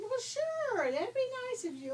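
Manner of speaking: sweet high little pig; high piggy voice. Acted.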